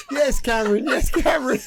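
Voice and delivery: high-pitched mock moaning reply through laughter; mimicked woman. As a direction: put on a high female voice, breaking into laughs. Imitates the woman in mate's story.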